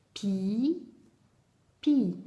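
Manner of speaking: "Pi, pi" in two separate, held syllables with a clear pause. Shown as a demonstration. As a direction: sound out "Pi, pi" for counting, one beat each. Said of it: "Combien il y a de syllabes quand je dis ça?".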